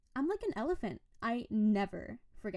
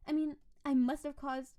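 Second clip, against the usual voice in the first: ordinary talk, nothing marked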